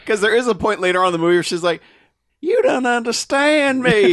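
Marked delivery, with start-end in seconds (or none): 2.4-4.1 high, loud, slow mock Southern accent. Kidnapped woman pleading, in a mock Southern accent. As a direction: overdo a thick Southern drawl. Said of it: "this lady's southern accent is thick".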